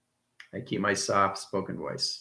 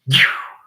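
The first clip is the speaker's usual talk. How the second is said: loud, short whooshing zap; sudden mouth-made blast effect; imitated zap; 4 semitones higher, 9 dB louder than usual. Shown as a demonstration. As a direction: make the sound of a charge shooting in. Imitates the charge hitting the car.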